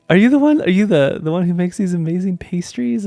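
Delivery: high, loud gushing question; starts high and loud, then eases a little; gushing admiration. Retold or imitated. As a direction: reenact an adoring fan asking. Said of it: "I'm so just filled with love".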